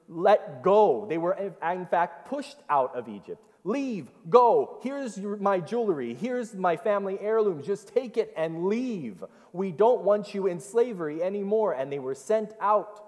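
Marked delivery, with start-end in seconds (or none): 3.6-11.7 higher, pleading voice of the Egyptians; urgent Egyptians. Retold or imitated. Preacher voicing Egyptians urging Israelites to leave, higher and pleading. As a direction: shove them out, begging them to go.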